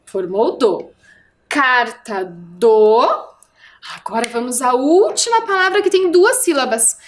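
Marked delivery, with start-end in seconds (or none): 1.5-3.3 "Carta do" in slow separate pieces, "do" held and rising; slow reading aloud. Shown as a demonstration. Teacher reading the title so far, "Carta do" slowly pieced out. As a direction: read "Carta do" very slowly, leaving it open.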